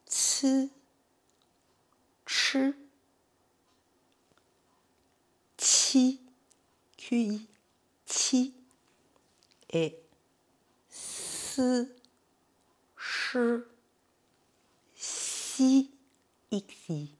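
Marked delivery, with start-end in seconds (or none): 0.1-8.5 "Tsu, chu, ti" one at a time, higher than chat; syllable drill. Shown as a demonstration. Teacher reviews C I with learners, isolated high model syllables. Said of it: "on va revoir encore une fois avec C I". 10.9-15.9 "si, chi, si" higher, with long opening hisses; syllable drill. Shown as a demonstration. Teacher reviews the sounds with learners, high drawn-out syllables. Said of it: "on va revoir encore une fois".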